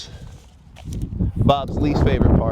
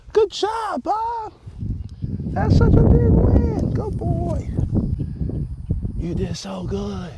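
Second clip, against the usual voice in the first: much higher, slow, sing-song praise for the horse; much slower than usual. Real, not acted. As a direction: gush over him like a proud owner. Praising horse after mounting.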